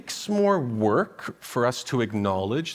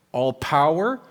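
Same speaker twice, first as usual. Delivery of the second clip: ordinary talk, nothing marked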